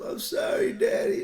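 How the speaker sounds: put-on very high child's apology; little girl voice. Daughter apologizing to her dad, high little-girl voice. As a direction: squeak it like a small girl. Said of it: "she stood up".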